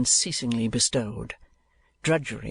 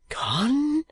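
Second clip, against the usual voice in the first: put-on startled boy; 12 semitones higher than usual. A narrator's reading. Smike alarmed at news.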